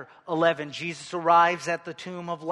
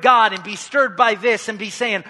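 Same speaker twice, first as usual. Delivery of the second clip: louder, higher preacher's plea to God; impassioned preaching; 6 semitones higher, 13 dB louder than usual. Acted. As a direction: preach it out with force, voicing a believer's reproach. Preaches at full force.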